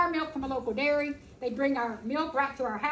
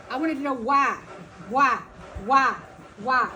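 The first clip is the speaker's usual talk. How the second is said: each why punched louder; emphatic whys; 4 dB louder than usual. Real, not acted. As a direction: hammer every why.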